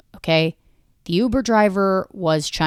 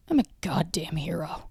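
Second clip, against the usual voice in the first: breathy mock-proud whisper to himself; 11 dB quieter than usual. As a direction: whisper it like a secret boast. Mock self-praise aside.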